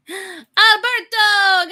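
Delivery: high, drawn-out cry of a name; gasp, then wail it long and high; dramatic wail. Acted. Streamer recalling a long-gone co-host, dramatic wail. Calling out an old co-host's name.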